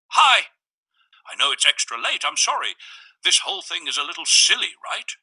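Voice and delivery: the man's voice. A narrator's reading.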